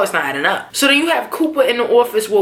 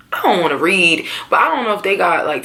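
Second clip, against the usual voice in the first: ordinary talk, nothing marked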